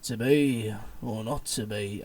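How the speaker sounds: low, slow Birmingham gangster; Tommy Shelby. Acted. Retrying Hamlet as a Peaky Blinders gangster, low and slow. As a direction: drag it out low in a Brummie accent. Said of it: "sounded like, like Tommy Shelby from Peaky Blinders".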